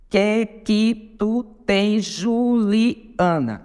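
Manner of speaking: very slow, syllable by syllable; contando sílabas. Shown as a demonstration. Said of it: "Sete sílabas, conte comigo". (escandindo devagar, sílaba por sílaba)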